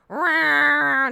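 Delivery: loud, high angry cat yowl; imitated cat fit. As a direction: screech like a cat throwing a fit. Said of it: "throwing a fit vo-, vocally".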